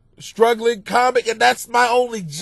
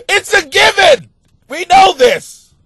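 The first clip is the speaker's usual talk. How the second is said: higher and louder, slow exclaimed; incredulous shout; 11 semitones higher, 7 dB louder than usual. Real, not acted. Host scoffing at the news report, incredulous shout. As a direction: yell it like it's obvious. Mocking obvious news details.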